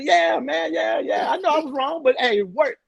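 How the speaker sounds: high, loud hyped-up yelling; mock hyped shout. Coach retelling how he hyped the team, high and loud. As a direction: pump the boys up, half joking. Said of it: "I used to".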